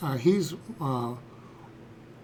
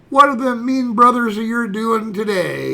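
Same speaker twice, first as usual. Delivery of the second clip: a hint of fussing father, louder and higher; mock doting dad; 4 semitones higher, 6 dB louder than usual. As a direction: imitate dad siding with his little girl. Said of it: "the way it comes across to me".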